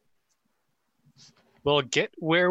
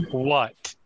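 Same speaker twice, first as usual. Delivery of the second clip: normal talking voice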